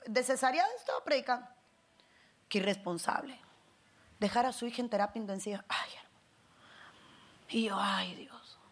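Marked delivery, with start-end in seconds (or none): 5.7-8.6 breathy whispered sigh to God; whispered lament. Retold or imitated. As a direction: whisper it as if ashamed. Whispered despair retelling shame.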